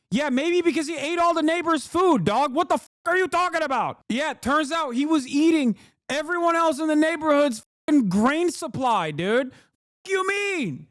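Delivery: high-pitched, exasperated rant; incredulous mock outrage. Streamer mocking the oppressed refugee claim, incredulous mock outrage. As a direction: argue back in disbelief, voice shooting up.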